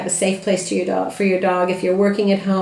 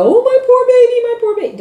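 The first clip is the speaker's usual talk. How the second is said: mock gushing baby talk, much higher and louder; gushing over puppy; 7 dB louder than usual. Shown as a demonstration. Owner rushing to the crated puppy: mock gushing baby talk, much higher. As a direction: coo over the poor baby, overdone on purpose. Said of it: "I don't run to the door and go".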